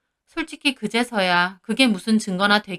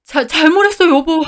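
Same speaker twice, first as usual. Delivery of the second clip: higher, louder pleading man's voice; begging husband; 6 semitones higher, 6 dB louder than usual. Acted. Husband caught cheating begs his wife, higher pleading voice. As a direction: beg for forgiveness in a panic. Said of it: "막 빌더라고요".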